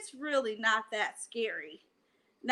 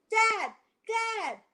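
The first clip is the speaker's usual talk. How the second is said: calling dad; 7 semitones higher than usual. Acted. Child calls father in fear.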